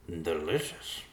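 lightly muffled, as if mid-chew; mumbling while chewing. A narrator's reading. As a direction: mumble through a mouthful. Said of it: "he mumbled as he chewed".

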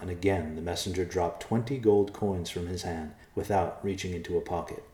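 usual speaking voice

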